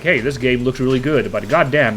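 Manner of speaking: ordinary talk, nothing marked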